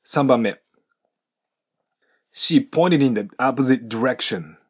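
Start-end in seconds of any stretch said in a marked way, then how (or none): 2.3-4.5 slow, "pointed in" joined as din; linking demo. Shown as a demonstration. Teacher reads example sentence slowly for linking. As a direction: say it slowly with links. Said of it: "ここでのポイントは".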